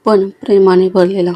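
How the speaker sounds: usual speaking voice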